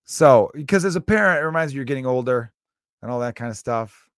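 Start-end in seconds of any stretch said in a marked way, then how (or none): none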